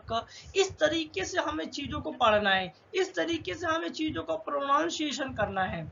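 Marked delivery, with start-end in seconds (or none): none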